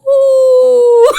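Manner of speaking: loud, high ghost howl; badly faked spooky wail. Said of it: "that was a bad one".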